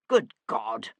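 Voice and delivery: low, indignant roar, lightly done; restrained roar of disbelief. A narrator's reading. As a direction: exclaim it deep and gruffly indignant. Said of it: "roared Jarvis".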